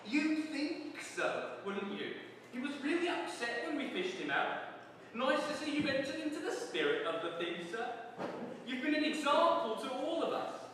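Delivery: smug guard grinning through it; grinning warder. Acted. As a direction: savor the joke on him. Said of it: "The warder grinned".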